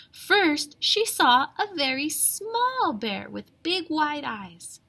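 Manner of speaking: plain reading voice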